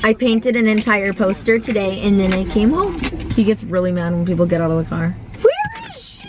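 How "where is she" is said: high squeaky voice for the puppy; high-pitched pretend puppy whine; dog's voice. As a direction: ask it as the whining puppy. Dog asking for owner.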